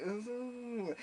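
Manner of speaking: high, soft drawn-out ooh, imitating drunkenness; mock woozy ooh; tipsy wobbly ooh. Tipsy passenger getting on the tube, woozy. Completely pissed.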